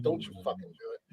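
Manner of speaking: unvoiced hissed warning; whispered threat. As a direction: warn her under your breath. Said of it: "She's like".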